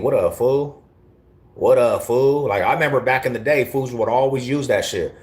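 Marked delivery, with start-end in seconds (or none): 0.0-2.5 lightly imitating a California homie, a bit higher; mild Cali slang imitation; Cali greeting. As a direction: toss out the Cali "What up, fool?". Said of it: "that's a Cali thing".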